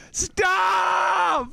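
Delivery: long, high-pitched yell; protesting shout. Real, not acted. Teased about age, protests loudly.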